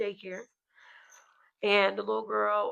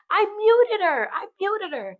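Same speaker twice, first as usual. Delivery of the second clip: put-on much higher, slow, proud child voice; little girl's voice; 12 semitones higher, 7 dB louder, slower than usual. Little girl telling mom she muted a player, high childish voice. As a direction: play the kid proudly confessing, high and slow. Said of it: "and she goes".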